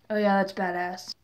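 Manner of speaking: low, quiet mock-cool delivery; imitated bad acting. Mocking Jace's acting in a recap, low and underplayed. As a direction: toss it off low, like a bad actor.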